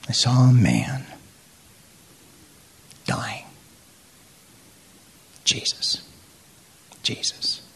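halting, sinking into a whisper; retold grave confession, fading to breath; very serious. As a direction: leave long silences, dropping to a reverent whisper. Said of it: "he got very, very serious".

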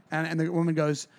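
ordinary talk, nothing marked

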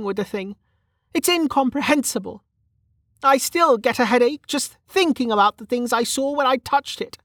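high-pitched frustrated character voice; Velika, frustrated. Acted.

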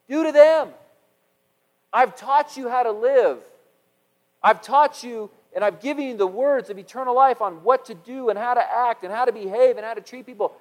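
loud, driving preacher voice; full-force preaching. Acted. Pastor preaching to his congregation, loud and driving. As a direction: preach it out to the whole church.

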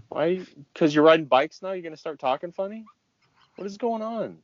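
incredulous. Real, not acted. Mock outrage at French word.

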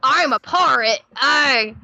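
playful Cockney buccaneer put-on; Cockney pirate. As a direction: growl out a mock Cockney pirate intro with arg. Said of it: "it was like a Cockney thing".

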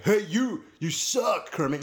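loud, high heckle at a puppet; mock confrontation. Fan confronting the new Kermit, mock-angry. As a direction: call him out with playful, hammed-up aggression. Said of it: "confront him about it. Like".

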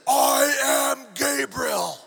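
slow, booming proclamation of a furious angel; Gabriel. Acted. Angel announcing himself to doubting Zechariah, furious drawn-out shout. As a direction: stretch every word, thunder it out in outrage. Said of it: "do it in the voice I'm imagining".

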